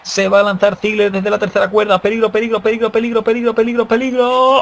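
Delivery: building from tense to shouted; rising excited call. Real, not acted. Danger builds before a dive. ("peligro" cada vez más alto y fuerte)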